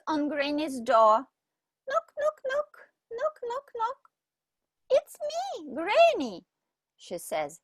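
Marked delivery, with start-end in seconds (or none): 1.9-6.5 put-on much higher girl's voice; little girl voice. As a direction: sing out the knocks like a small girl. Girl voiced knocking at door.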